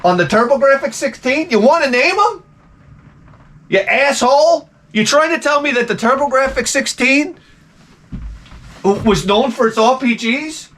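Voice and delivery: high, incredulous outrage; can't believe what he's hearing; indignant disbelief. Acted. Ranting at the AI.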